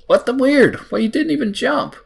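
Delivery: high, loud yelp of baffled surprise; startled disbelief. Real, not acted. Gamer reacting to a character who didn't even jump, startled disbelief. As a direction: burst out in high-pitched disbelief.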